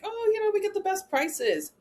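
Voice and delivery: high, chirpy imitation of a pushy agent; mock salesy agent. Mocking cheap booking sites to trainees, high and chirpy. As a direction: parody the bargain seller, pitched well up. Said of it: "walk around talking about, like".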